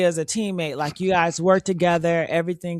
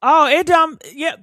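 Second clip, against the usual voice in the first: loud sassy; 8 semitones higher, 6 dB louder than usual. Real, not acted. Sassy defense of body.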